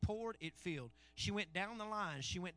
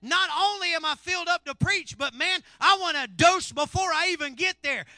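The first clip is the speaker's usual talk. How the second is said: loud, high, driving sermon; full-force preaching; 10 semitones higher, 15 dB louder than usual. Acted. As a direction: preach it out to fill the room.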